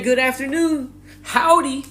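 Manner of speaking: high, sing-song cheery greeting; overly friendly locals. Retold or imitated. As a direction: gush hello like a too-nice stranger. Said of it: "everybody was saying that shit".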